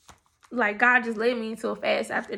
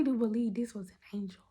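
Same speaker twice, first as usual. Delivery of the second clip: whispering; 2 semitones lower, 10 dB quieter, faster than usual. Real, not acted. Awed aside about door greeter.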